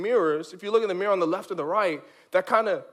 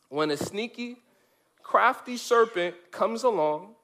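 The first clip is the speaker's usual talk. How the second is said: usual speaking voice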